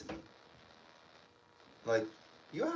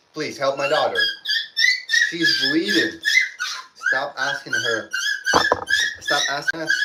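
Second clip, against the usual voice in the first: desperate plea; 6 semitones higher, 7 dB louder than usual. Retold or imitated. Pleading father at hospital.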